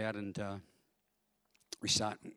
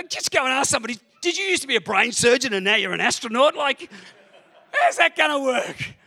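loud, high-pitched comic exasperation; exasperated ranting; 12 dB louder than usual. Retold or imitated. Pastor arguing with the nagging voice, loudly exasperated. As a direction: rant incredulously at top pitch.

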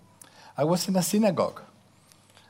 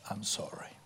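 soft, hushed "I'm sorry"; whispered apology; 5 semitones lower, 13 dB quieter than usual. Shown as a demonstration. Parent finally saying sorry to child, whispered. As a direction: breathe it gently. Message never heard from parents.

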